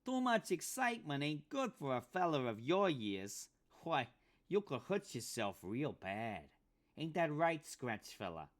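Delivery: Pinky mocking the old undertaker, high pinched voice. A narrator's reading. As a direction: sneer it out like a grinning weasel. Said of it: "A pinched voice, high, all nose and teeth".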